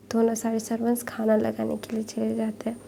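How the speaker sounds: ordinary delivery, nothing marked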